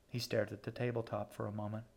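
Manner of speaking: usual speaking voice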